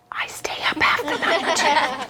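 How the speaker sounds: soft confiding whisper; whispered aside. Real, not acted. Pastor confides to the kids in a whisper.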